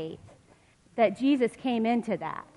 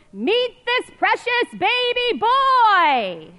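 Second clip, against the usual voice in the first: much higher, louder, slow; joyful angel shout; 13 semitones higher, 9 dB louder, much slower than usual. Acted. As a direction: sing it out like angels rejoicing. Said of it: "Angels' voices shout with joy".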